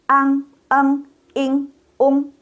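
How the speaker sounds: Ang, eng, ing, ung, high and level; model ng endings. Shown as a demonstration. Teacher models four sounds like Indonesian, high sustained drill. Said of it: "sama dengan bahasa Indonesia".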